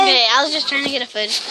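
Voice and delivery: ordinary talk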